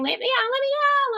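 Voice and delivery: high desperate repeated yell; inmate screaming. Retold or imitated. Inmate in next cell begging to be let out, high screaming. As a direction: keep screaming it through the door. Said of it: "Lots of screaming".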